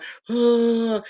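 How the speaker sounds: a quick high gasp like a sob; sharp crying gasp. Retold or imitated. Demonstrating to the audience a sudden sob gasp. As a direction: catch your breath mid-cry. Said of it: "like that crying".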